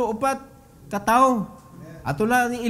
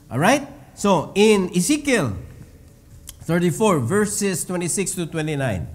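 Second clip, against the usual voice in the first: normal talking voice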